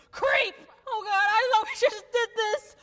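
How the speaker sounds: high exasperated cry as pain hits; pained exasperation. Acted. Woman in labor as another contraction hits, pained exasperation. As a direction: cry out against the next pain.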